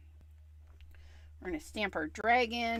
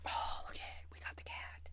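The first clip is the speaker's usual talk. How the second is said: delighted hush; whisper; 15 dB quieter than usual. Real, not acted. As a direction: whisper it, pleased. Pleased whisper over stamp.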